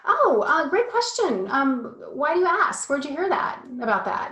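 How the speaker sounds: a parent faking calm with a big bright voice; forced calm cheer. Retold or imitated. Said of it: "I was very calm".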